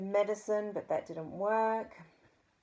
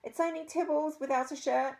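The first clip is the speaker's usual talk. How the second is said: much higher, chirpy and innocent; cheerful boy voice; 10 semitones higher, 4 dB louder than usual. A narrator's reading.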